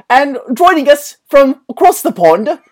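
hammed-up bad English accent for the intro; a jokey posh English lilt. Host introducing guests in bad English accent. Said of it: "[name]'s gonna do that bad English accent".

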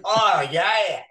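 hammed-up Paul Hogan Aussie; Australian ad-man twang, played for laughs; bad Australian accent. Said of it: "like a really bad Paul Hogan commercial".

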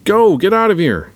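high, loud shouted order; urgent yell. A narrator's reading. Poe sending Finn away under attack, urgent yell. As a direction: yell it across a hangar. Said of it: "Poe yells to Finn".

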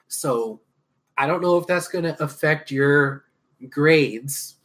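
ordinary talk, nothing marked

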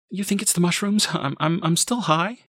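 high, stammering disbelief; anxious questioning. A narrator's reading. Mother asks if drugs explain it, high anxious stammer. Mother doubting herself, upset.

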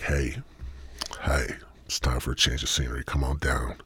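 soft, low, whispered invitation; mock seductive whisper.

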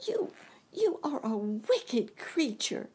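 stammering outburst at the witch; Dorothy crying out. A narrator's reading. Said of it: "cried Dorothy".

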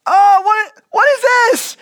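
high, loud startled cry of a blind man. Acted. Blind man startled by spit mud on his face, loud and high. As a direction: jump in shock at the sudden mud.

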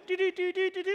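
quick high-pitched "do do do" like code beeps; rapid mock Morse code blips. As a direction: imitate a telegraph signal with the voice. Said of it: "It's like Morse code".

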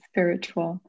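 ordinary talk, nothing marked

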